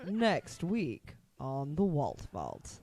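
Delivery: announcer voice. Pretend. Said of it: "You try it".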